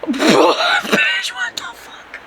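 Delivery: high-pitched shriek of disbelief; shocked outburst. Retold or imitated. Driver told by officer she is in Mexico, shocked shriek.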